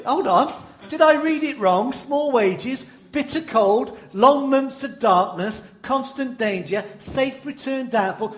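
mock disbelief at the terms; incredulous rereading. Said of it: "You might say".

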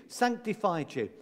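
usual speaking voice

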